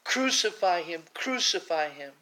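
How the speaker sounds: loud repeated chant-like shout; mob shouting. Retold or imitated. The crowd answering Pilate, loud angry shouting. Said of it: "They cried even louder".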